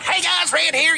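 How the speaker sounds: bright energetic channel opener; excited vlog intro. Shown as a demonstration. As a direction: burst in like you're starting a video. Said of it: "it gets a little more high pitch".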